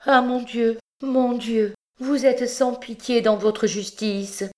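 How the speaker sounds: high, anguished cry to God; mother crying out in despair. Acted. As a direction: wail it out in despair. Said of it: "en se tordant les bras de désespoir".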